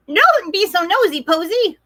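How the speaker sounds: high duck voices; quacking ducks. Acted. Ducks warning Posy, high quacky voice. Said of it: "quacked Davy and Debbie".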